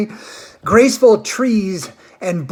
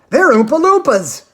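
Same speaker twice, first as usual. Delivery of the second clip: much higher and louder, grand reveal; proud Mr Wonka; 8 semitones higher, 7 dB louder than usual. A narrator's reading. Wonka proudly telling the children who they are. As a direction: announce them like a showman.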